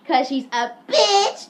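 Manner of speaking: hammed-up jeer stretched out; mock insolence. [name] answers the principal, slow mock jeer.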